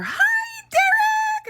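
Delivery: very high, loud sing-song hello; mock a gushing aide at the bus door; overly cheery greeting. New aide greets son loudly.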